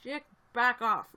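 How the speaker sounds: loud, slow back off; a fed-up loud warning; retold snap. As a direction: tell her off, loud and slow. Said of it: "I was just like".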